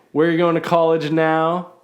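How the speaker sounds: usual speaking voice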